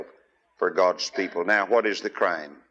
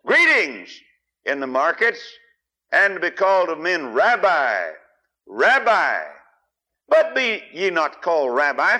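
preaching scripture reading; 3 semitones higher than usual. Acted. Preacher reads scripture to congregation, fervent. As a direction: read it out to the congregation with fire.

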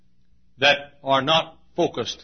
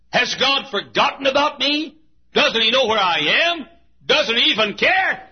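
fervent preaching; 8 semitones higher than usual. Acted. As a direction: preach Asaph's lament at full force. Preaching Asaph's complaint.

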